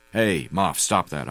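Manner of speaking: ordinary delivery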